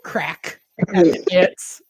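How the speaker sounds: ordinary delivery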